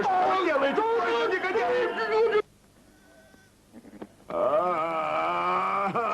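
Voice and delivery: outraged cry. Acted. Robbers seized by the boys.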